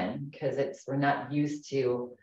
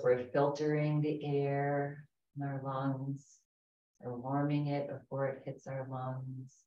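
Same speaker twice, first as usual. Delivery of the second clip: guided meditation. Acted. Leads a guided breathing meditation.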